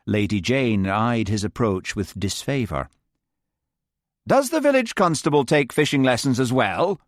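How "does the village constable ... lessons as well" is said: higher, scornful demand; haughty demand. Acted. Lady Jane challenging the constable, high scornful demand. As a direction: sneer at the constable. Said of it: "she demanded".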